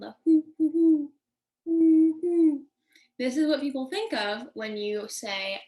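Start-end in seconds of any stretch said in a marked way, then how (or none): 0.2-2.7 loud, high imitated owl hoots; classic owl hoot. Shown as a demonstration. Teacher demonstrating the classic owl sound, loud rounded hoots. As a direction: hoot like a great horned owl. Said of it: "the classic owl sound".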